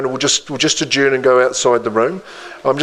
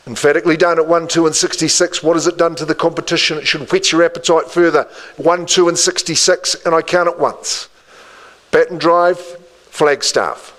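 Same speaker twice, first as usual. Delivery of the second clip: rising auction call; 4 semitones higher than usual. Acted. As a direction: drive the bidding with quick energy. Auctioneer ramps up the calling.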